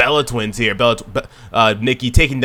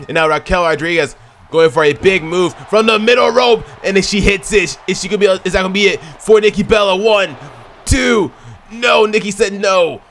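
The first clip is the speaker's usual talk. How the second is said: excited commentary; 6 semitones higher than usual. Real, not acted. Commentator hyping the pin count to viewers, excited and higher. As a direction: call the near fall like it's the finish. Near-fall count during climax.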